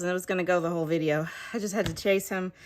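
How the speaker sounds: ordinary delivery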